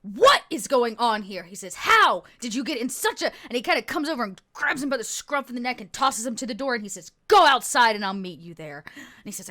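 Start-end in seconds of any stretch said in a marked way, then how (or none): 0.0-1.4 loud, slow, demanding question; angry commander. Acted. Commando confronts the soldier. 1.8-3.3 loud, indignant scolding; angry commander. Acted. 7.3-8.8 loud, forceful order; stern commander. Acted.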